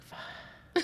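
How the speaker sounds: a whispered sigh of a curse; sighed under breath. Shown as a demonstration. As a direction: breathe it out without really saying it. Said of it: "You sigh it".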